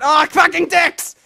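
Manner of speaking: loud, high, drawn-out curse; slow, high shout of frustration; furious outburst. Real, not acted. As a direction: yell it in sudden rage. Falls in game mid-plea.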